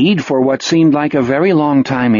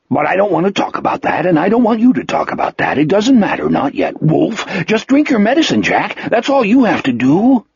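high, hurried, insistent character voice; pleading insistence with wide pitch swings; Wolf, agitated; 6 semitones higher than usual. Acted. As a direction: brush the subject off in a rush.

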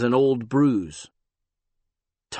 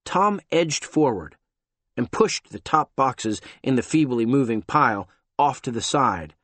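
ordinary delivery

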